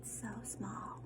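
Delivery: a soft breathy whisper; whispered aside. Real, not acted. Teacher marvelling at the tiny seed in a whisper. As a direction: hush it like a secret.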